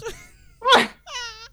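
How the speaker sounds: high burst of laughter; sudden high laughing fit; loud laugh. Real, not acted. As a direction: crack up loudly. Laughing burst after joke.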